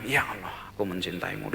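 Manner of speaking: a hushed, quiet devotional line; soft prayer. Retold or imitated. The traveler entering the forest, praying quietly to God. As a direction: say it low, almost to yourself.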